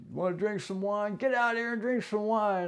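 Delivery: put-on high, pushy drunken offer; drunk gunman. Drunk gunman pushing wine on the homeowner, high and insistent.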